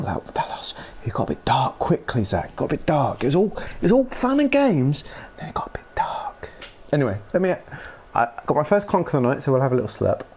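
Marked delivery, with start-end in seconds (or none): 5.4-6.5 whisper. Real, not acted. Dark-joke aside to chat.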